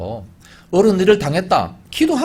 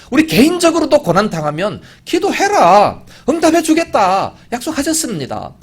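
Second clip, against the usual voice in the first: high, raised proclaiming voice; fervent preaching; 8 semitones higher, 6 dB louder than usual. Acted. Preacher urges individuals in hardship to pray, fervent preaching. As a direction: proclaim the promise with force.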